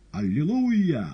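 full-voiced high exclamation; piercing cry. A narrator's reading. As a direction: shriek it out like a frenzied bandleader. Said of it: "пронзительно вскричал".

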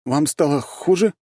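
high, rising worried question; taken aback, voice lifted high; surprised. A narrator's reading. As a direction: ask in startled concern, slowing at the end. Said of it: "удивился он".

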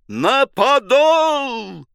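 подол held very high and slow. A narrator's reading. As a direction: push подол up to a peak cry. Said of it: "взвыл команду". (ещё выше и протяжнее)

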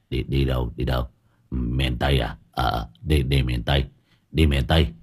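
put-on low, soft slurred drunk voice; drunken slur. A narrator's reading. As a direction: slur the words, half asleep and drunk. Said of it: "giọng lè nhè".

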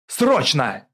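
one loud, urgent word; shouted order. A narrator's reading. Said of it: "крикнул Журбин". (срочный окрик)

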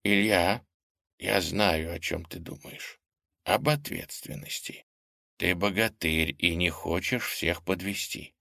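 quiet, earnest grandmother; serious and soft. Acted. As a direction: speak softly and seriously. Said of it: "серьёзно сказала она".

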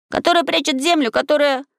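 put-on high-pitched boy pressing on; high boyish persistence; ringing question. Boy continues his ringing question.

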